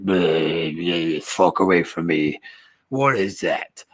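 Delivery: low, slow, disgusted orc growl; cruel orc. Retold or imitated. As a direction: sneer it down at the prisoner. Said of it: "the cruel orc's like".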